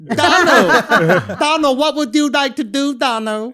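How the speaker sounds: badly faked Vietnamese accent, breaking into laughs; mock Vietnamese accent. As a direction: put on a crude Vietnamese accent, as a joke. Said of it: "Is that a good accent?".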